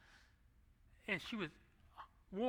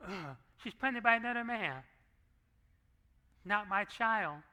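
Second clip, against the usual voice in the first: louder, higher, scornful rejection; disgusted refusal; 5 semitones higher, 6 dB louder than usual. Retold or imitated. Imagined Joseph rejecting Mary, disgusted and loud. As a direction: spit it out like a man refusing.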